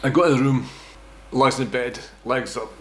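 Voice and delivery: ordinary talk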